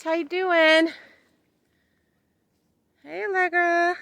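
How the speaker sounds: high, loud sing-song greeting. Real, not acted. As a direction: greet the animal in a coo. Greeting the animals.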